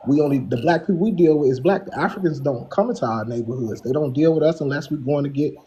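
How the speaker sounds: ordinary talk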